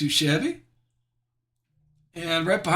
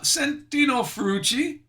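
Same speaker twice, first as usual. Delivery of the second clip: higher-pitched, lightly put-on Italian accent; mock Italian accent; 7 semitones higher than usual. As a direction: say it with playful Italian flair. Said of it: "in my best Italian accent".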